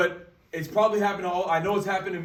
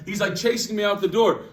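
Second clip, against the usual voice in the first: usual speaking voice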